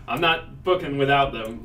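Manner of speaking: called out loudly. Acted. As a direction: project it to the others. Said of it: "out loud".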